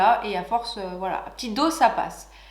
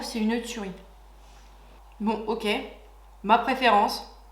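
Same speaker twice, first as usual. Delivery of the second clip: ordinary talk, nothing marked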